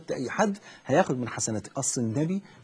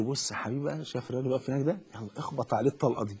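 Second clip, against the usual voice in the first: conspiratorial father; 3 semitones lower, 7 dB quieter than usual. Retold or imitated. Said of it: "وأقول له".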